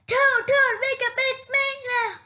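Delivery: high and loud, calling Toad awake; excited wake-up call. Acted. Frog calls excitedly to wake Toad, high and loud. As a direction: rouse your friend with big news.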